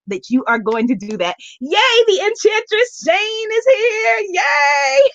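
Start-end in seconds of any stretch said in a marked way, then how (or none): 1.6-5.0 high, drawn-out happy squeal of welcome; delighted cheer. Real, not acted. Host welcomes an arriving goddess on the livestream, delighted cheer. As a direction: cheer a friend's arrival, pitched right up.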